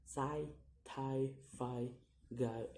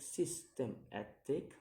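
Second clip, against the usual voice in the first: "systematic" split into syllables; separated syllables on "systematic", rising at the end. Shown as a demonstration. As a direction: sound out "systematic" piece by piece. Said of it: "count the word syllable backwards".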